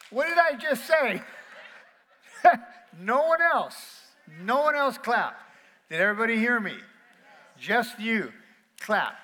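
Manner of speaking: loud, high, laughing reprimand to the crowd; laughing scold. Real, not acted. Preacher scolding the congregation for clapping, loud and laughing. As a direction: call them out, half laughing.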